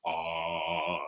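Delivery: a low, soft sung ah. Shown as a demonstration. Warming up the kids' voices, a low ah. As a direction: sink down to your lowest note. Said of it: "find our low voice".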